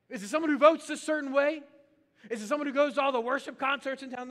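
high, loud rhetorical challenge; preaching loud. Real, not acted. Pastor challenging the congregation loudly, rising and forceful. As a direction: fire the questions at the congregation.

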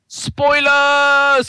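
loud high yelp; mock outcry. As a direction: cry out at the spoiler. Mock protest at spoiler.

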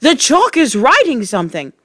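high-pitched excited cry; screamed. A narrator's reading. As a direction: call it out in mild alarm. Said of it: "screamed Nigel".

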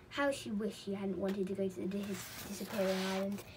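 plain reading voice

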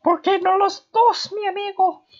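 very high-pitched mock Spanish accent. Player joking to "mi amigo", imitated Spanish accent. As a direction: squeal it in a put-on Spanish accent. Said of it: "imitating a Spanish accent".

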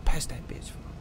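hushed whispered call. Real, not acted. Player whispering to Jojo to pass it.

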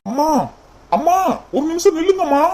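high call after her, அம்மா stretched. Acted. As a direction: call her back before she goes.

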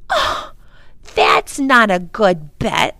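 put-on a girl wriggling out of a dare; hedging. A narrator's reading. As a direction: dodge the bet. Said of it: "Lindy hedged".

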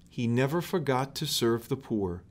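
plain reading voice

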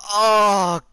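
high loud crying oh; anguished cry. Real, not acted. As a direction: cry out in dismay. Caught by the killer.

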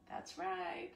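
normal talking voice

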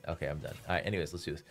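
normal talking voice

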